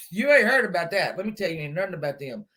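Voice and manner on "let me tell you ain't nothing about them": usual speaking voice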